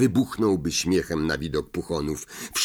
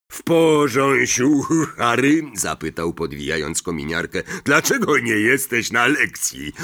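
Hagrid; 4 semitones higher, 4 dB louder than usual. A narrator's reading. Hagrid voiced in audiobook.

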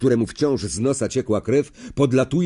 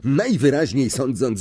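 ordinary delivery

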